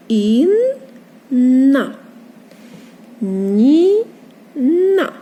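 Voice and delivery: Інна and Ніна slowly, a pause between syllables; split into syllables. Shown as a demonstration. Teacher rereading names for children, Інна and Ніна in separated syllables. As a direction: break Інна and Ніна into long halves. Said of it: "два склади".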